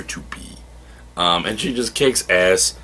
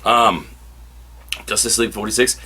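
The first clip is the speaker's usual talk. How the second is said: usual speaking voice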